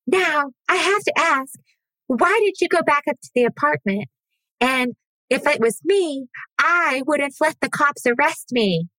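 high prissy mocking voice. Mocking the interviewer with a high prim voice.

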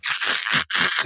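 voiceless throaty crackling noise; imitated static crackle. Host mimicking his faulty earphones, voiceless static crackle. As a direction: make the hiss and crackle of bad earbuds.

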